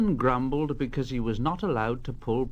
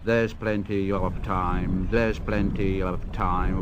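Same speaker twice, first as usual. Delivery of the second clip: grumpy coaches; 7 semitones lower than usual. Acted. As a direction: drag your feet and grumble. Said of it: "coaches were very grumpy".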